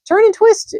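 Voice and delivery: very high, loud honking goose cries; a goose barking orders to the pig; shrill-pitched urgent goose shouts; excited goose. A narrator's reading. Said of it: "honked the goose".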